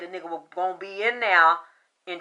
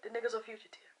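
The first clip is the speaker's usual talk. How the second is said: whisper; 13 dB quieter, faster than usual. Real, not acted. Confiding aside.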